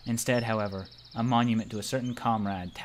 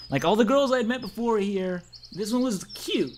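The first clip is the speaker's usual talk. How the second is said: higher, lightly put-on girl voice; girly voice; 10 semitones higher, 3 dB louder than usual. Reading the game's girl line, higher. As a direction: play the cute girl narrating, a touch higher. Voicing a girl character in game.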